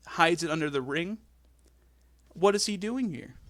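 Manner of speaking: ordinary talk, nothing marked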